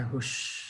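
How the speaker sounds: soft, low exhaled "whew"; sigh of relief. A narrator's reading. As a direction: let out a long quiet breath after danger. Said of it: "और कहता है". (राहत की धीमी, लंबी साँस)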